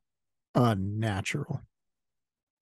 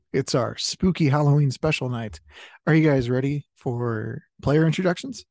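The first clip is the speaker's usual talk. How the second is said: ordinary delivery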